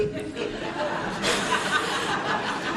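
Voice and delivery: high breathy laugh; high airy laugh. Real, not acted. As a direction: laugh it off helplessly. Laugh at daughter's question.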